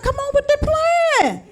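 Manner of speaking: squealing come on; eager. Retold or imitated. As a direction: beg for the plan to start. Eager for the plan.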